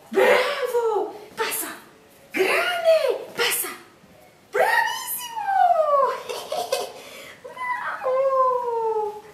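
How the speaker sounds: very high, shouted praise; talking to a dog. Real, not acted. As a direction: cheer the dog like it won gold. Cheering the dog's jumps.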